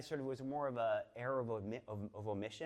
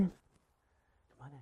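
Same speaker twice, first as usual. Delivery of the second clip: slow breathy whisper, much softer; 3 semitones higher, 15 dB quieter, much slower than usual. Shown as a demonstration. Guest whispered in slowly at the door. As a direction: whisper the welcome, drawing it out slowly. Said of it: "whispered second take".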